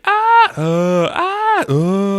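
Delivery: loud, high alternating shrieks; imitated screaming. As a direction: trade comic screams back and forth. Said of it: "they're screaming at each other".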